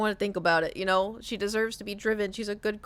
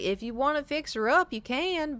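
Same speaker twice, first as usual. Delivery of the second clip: higher, imitated Texas twang; Texas accent; 6 semitones higher than usual. Mom's boyfriend on fixing the old car, Texas accent. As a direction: do a Texan, pitched up. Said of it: "very Texas".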